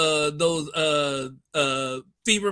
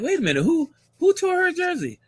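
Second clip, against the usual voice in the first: mock surprise; 10 semitones higher than usual. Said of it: "I was like".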